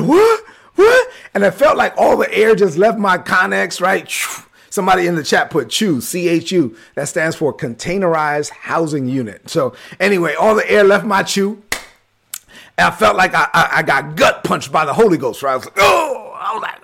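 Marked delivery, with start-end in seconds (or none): 0.0-1.1 very high-pitched incredulous yelps; stunned disbelief. Retold or imitated. Acting out stunned reaction. 15.8-16.4 high drawn-out "oh" as if hit; gut-punched groan. Retold or imitated. Acting out gut-punch reaction.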